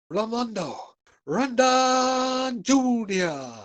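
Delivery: ring announcer. Pretend. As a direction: boom the name like a boxing MC. Savouring a fighter's name aloud.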